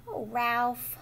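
ordinary delivery

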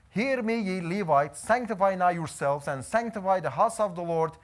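ordinary delivery

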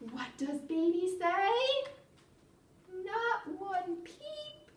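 animated, sing-song picture-book reading; playful storytime narration with stretched key words; reading to children. Acted. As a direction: read it to toddlers with lively, swooping pitch.

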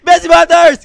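high, loud yelled name; shout-out. Shown as a demonstration. Caster giving a requested shout-out to a viewer, loud. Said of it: "Pa-shout daw eh".

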